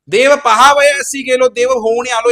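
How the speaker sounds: loud, high declaiming of a verse; ringing, slowed scripture quote; emphatic verse quote. Acted. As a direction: proclaim the line to the whole hall.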